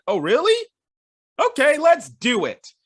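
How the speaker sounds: eager Ash. Retold or imitated. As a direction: play it as keen kids jumping at a challenge. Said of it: "Ash and company are like".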